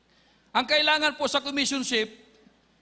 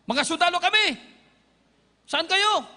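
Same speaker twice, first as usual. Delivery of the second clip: retold shout; 5 semitones higher than usual. Soldiers shout to the hiding group, raised calling voice. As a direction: call out across the jungle to find them. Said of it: "sumigaw na".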